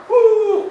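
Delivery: a sudden high loud whoop. Retold or imitated. Founder cheering his first customer, high whoop. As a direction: whoop like you just won.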